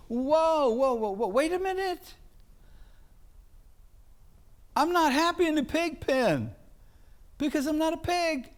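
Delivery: high, urgent cry of a son coming to his senses; sudden high-pitched realisation; son waking up in the pig pen. Acted. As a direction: call out high and startled, realising who you are.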